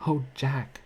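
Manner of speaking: high, loud cry of alarm; a wife's startled cry to her husband; frightened cry. A narrator's reading. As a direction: cry out as the shell hums over. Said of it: "she cried".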